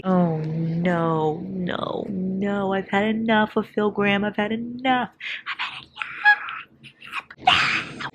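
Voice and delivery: high, whining no, no, then a breaking sob; exasperated fangirl wail; groaning in real frustration at the book; whiny mock despair. Real, not acted. Fed up with Phil Graham.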